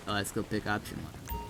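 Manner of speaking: normal talking voice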